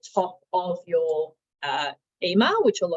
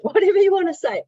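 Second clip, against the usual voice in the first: laughing, higher and louder; amused; 11 semitones higher, 8 dB louder than usual. Real, not acted. Presenter joking about placeholder names, amused. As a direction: toss it off with a laugh.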